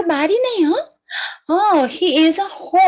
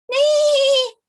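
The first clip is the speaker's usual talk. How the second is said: horse neigh; 10 semitones higher than usual. Pretend. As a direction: call back like a horse far away. Horse puppet answers the call.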